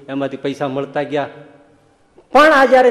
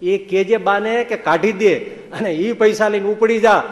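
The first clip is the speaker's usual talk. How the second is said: saint's proclamation; 6 semitones higher, 6 dB louder than usual. Retold or imitated. Swami's prophetic instruction.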